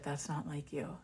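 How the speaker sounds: quiet suspicion. Retold or imitated. Ex doubting her.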